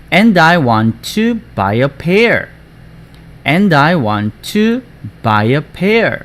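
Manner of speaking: slow "And I want to / buy a pair", small break before "buy"; phrased with breaks. Shown as a demonstration. Teacher shows students where to break, slow phrased reading. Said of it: "이렇게 끊어서 읽으면 좋을 것 같습니다".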